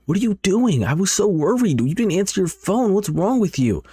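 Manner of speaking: high, upset scolding without much extra volume; acted yelling. Worried friend confronts the girl who ignored her, acted yelling. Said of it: "She starts yelling at her instantly".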